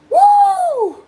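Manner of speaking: very high, loud whoop; piercing playful whoop; falsetto woo. Pretend. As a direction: hoot a falsetto woo that slides down.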